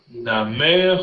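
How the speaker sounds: "namer" slow, stressed on MER; stress on final syllable. Shown as a demonstration. Teacher contrasting two Hebrew words, final stress on "namer". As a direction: draw out the end of "namer". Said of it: "la dernière syllabe".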